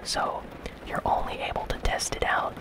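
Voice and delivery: ordinary talk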